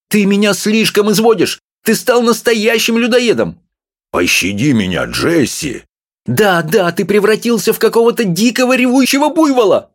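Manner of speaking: high, fussy little woman scolding; wife's voice. A narrator's reading. Small wife scolding her husband, high and fussy. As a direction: nag him in a high voice.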